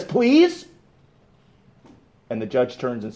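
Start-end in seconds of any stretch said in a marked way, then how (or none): 0.0-0.7 much higher, rising plea; lifted, pleading voice. Retold or imitated. Brother pleading with the judge to hug that lady, lifted voice. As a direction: raise the voice into an imploring plea, imitating him. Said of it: "lifts his voice, and he's weeping a little bit".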